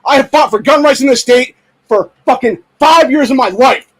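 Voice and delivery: shouting his anger at the gun groups; furious shout. Real, not acted. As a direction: blast them with real rage.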